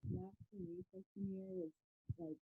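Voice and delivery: muffled, hand over the mic; speaking through a covered mic; hand over mouth. Real, not acted. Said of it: "your audio is a little bit muffled".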